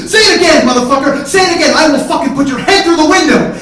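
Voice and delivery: full-force high shouted threat; enraged screaming. Retold or imitated. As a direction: scream it, out of control. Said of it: "And I'm screaming".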